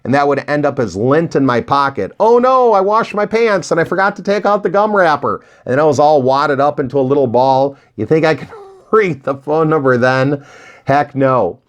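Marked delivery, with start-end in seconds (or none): 2.2-5.4 high, startled cry of dismay; hammed-up oh-no, up high; mock dismay. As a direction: play the panic of finding the washed wrapper. Retold panic over washed pants.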